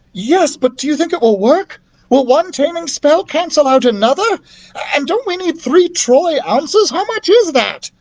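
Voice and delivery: put-on high, bright-eyed girl asking anxious questions; a child's voice, pitched high and eager; young girl voice. Child character voiced at length.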